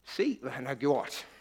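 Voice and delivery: soft and slow, a plain gift; preacher shows how he reads the verse to the congregation, gently and slowly; simple gentle reading. Shown as a demonstration. Soft slow tender reading. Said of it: "Jeg læste det bare som".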